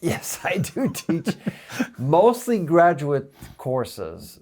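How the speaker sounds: slow, chuckling confirmation; amused admission. Real, not acted. Professor admits to the interviewer he does teach, amused. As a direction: laugh through a drawn-out yes. Amused reply to being asked.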